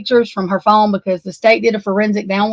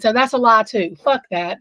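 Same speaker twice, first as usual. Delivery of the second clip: usual speaking voice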